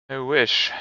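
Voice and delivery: ordinary delivery, nothing marked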